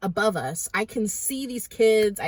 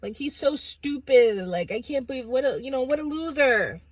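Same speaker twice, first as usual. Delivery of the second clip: put-on higher, louder taunting kid voice; mocking teenagers; 5 semitones higher, 3 dB louder than usual. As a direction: jeer like a cocky teen.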